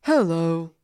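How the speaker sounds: understated, barely acted hello; unemotive greeting. Co-host imitating Mop a Top, unemotive hello. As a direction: imitate a voice actor who isn't stretching his voice. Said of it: "he doesn't do much acting".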